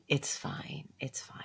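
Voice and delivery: very soft whisper; whispered self-soothing. Real, not acted. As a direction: reassure yourself under your breath. Soothing herself with invented happy ending.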